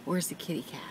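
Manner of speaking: put-on hushed whisper to a toddler. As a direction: breathe the question softly.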